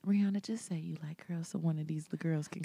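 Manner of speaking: pleading whisper. Real, not acted. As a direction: whisper a plea to her. Whispered plea to [name].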